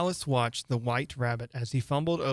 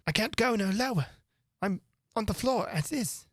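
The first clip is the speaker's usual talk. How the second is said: whiny Hatter; 9 semitones higher than usual. Acted. Hatter whining.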